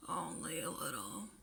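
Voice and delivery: low, soft murmur. Acted. Eraser downplaying his magic to Mike, murmured low. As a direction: shyly play it down, barely audible. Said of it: "Eraser murmured".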